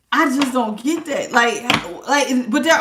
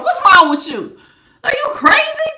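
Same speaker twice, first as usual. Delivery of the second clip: incredulous squeal; 14 semitones higher, 6 dB louder than usual. Real, not acted. Incredulous outburst.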